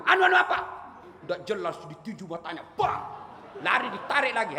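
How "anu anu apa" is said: high, loud angry demand. Acted. Angel demands an answer from the stammering master, loud and angry. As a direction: pounce on his stammer.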